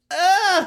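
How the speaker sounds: breathy exaggerated moan sound; mock sensual moan. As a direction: ham up a sensual groan. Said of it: "a sensual moan".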